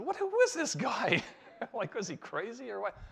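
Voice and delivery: high, loud, laughing disbelief; incredulous laughing outburst. Retold or imitated. Bible study members staring at the chemist, high loud laughing disbelief. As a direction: toss it out, half laughing, as if he's mad.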